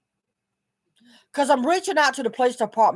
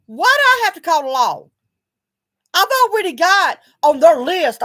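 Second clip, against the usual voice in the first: indignant outburst; 6 semitones higher, 7 dB louder than usual. Real, not acted. Indignant reply to neighbors.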